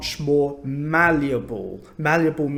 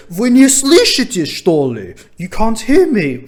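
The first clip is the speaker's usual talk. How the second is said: much higher, very loud mock doctor; imitating an irritated doctor yelling; exasperated shout; 9 semitones higher, 12 dB louder than usual. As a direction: shout it as if at someone deaf. Doctor snaps at supposedly deaf patient.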